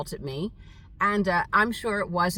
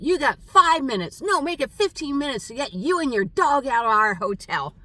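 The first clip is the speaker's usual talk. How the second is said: loud, higher mock yell of the manager; retold shouted eviction order; imitated screaming; 6 semitones higher, 5 dB louder than usual. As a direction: throw them out with a bark. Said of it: "she screamed at me".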